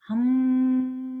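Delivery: long steady hum, held high and loud; a demo hum, held and ringing; sustained hum. Shown as a demonstration. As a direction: hum one long note. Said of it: "We're just gonna hum".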